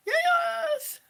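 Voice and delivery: very high, stretched-out yes; sustained high-pitched cheer; thrilled squeal. Real, not acted. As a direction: squeal it with joy. Thrilled at baby news.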